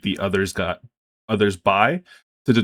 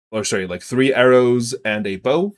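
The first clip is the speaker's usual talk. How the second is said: ordinary talk, nothing marked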